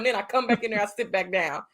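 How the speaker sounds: usual speaking voice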